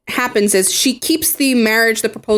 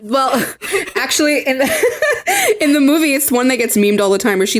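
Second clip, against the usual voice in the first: ordinary delivery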